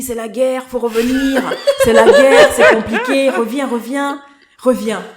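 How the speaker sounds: hammed-up panic, loud and laughing; loud, comically urgent plea breaking into laughter; mock alarmed shouting. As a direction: imitate frantic relatives calling someone home. Acted alarmed plea to come home.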